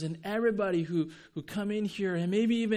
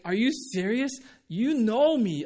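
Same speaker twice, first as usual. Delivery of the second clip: higher, louder incredulous protest; retold disbelief; 5 semitones higher, 7 dB louder than usual. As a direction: challenge it in emphatic disbelief. Retold astonished reply to Jesus.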